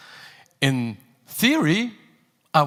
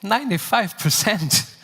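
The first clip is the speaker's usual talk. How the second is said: incredulous question, pitched up; surprised; 4 semitones higher than usual. Acted. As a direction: echo the number in disbelief. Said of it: "repeats the figure in disbelief".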